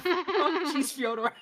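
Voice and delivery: high, laughing Morty whine. Acted. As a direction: giggle through a Morty voice.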